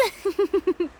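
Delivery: a short proud laugh; haughty little laugh. Acted. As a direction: laugh off the very idea, pleased with herself. Boasting of her stage talent.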